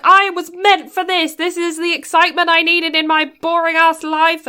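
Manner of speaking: high, loud mock-triumphant cry; exhilarated. Girl on scooter fleeing the chase, exhilarated. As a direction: belt it out like a thrilled getaway driver. Acting out thrilled scooter getaway driver.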